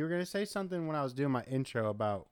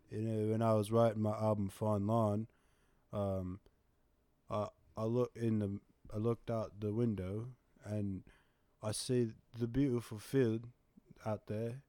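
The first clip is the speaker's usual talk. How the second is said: soft, dreamy, quieter British voice; 2 semitones lower, 4 dB quieter than usual. Pretend. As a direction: muse softly like a pop star in interview. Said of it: "this is the real one".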